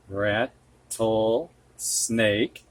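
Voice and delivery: Rattlesnake. stretched syllable by syllable; very slow syllables. Shown as a demonstration. Teacher to students, slowly sounding out rattlesnake. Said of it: "modelling the compound word".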